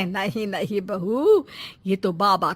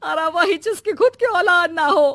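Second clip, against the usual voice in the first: higher, louder barbed accusation; pointed jab; 7 semitones higher, 3 dB louder than usual. Acted. Mother-in-law jabs at the childless wife, higher and louder. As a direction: twist the knife.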